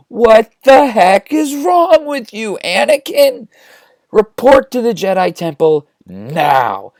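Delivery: high, loud, drawn-out mock yell; outraged yelling. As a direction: bellow slowly at an outrageous apprentice. Obi-Wan explodes at Anakin.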